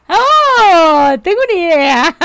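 loud, high burst of sudden inspiration; excited idea. Real, not acted. Streamer suddenly gets a scheme while playing, excited. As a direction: blurt it out, thrilled. Excited realization mid-game.

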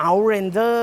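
high, loud put-on English accent, rising at the end. Shown as a demonstration. Car reviewer trying out the accessory brand's name, put-on English accent. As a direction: show off an English pronunciation. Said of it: "อยากใช้สำเนียงภาษาอังกฤษอะนะ".

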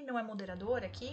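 ordinary delivery

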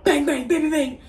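high, quick mock gunfire bangs; imitated gunshots. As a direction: spit out the bangs like shots.

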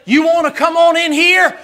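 high, loud taunting dare; challenge. Retold or imitated. Wrestler daring the heckler into the ring, taunting. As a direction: dare him to step inside. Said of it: "that guy said".

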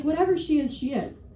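normal talking voice

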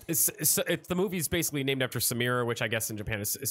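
ordinary delivery

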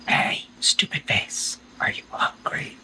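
soft slow teasing whisper; sneaky whisper to the guard dog; taunting whisper. Acted. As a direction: whisper the insult to sneak past.

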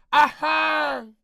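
mock coy moan. Streamer reading a viewer's cutesy line as a slow mock moan. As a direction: ham up a coquettish sigh.

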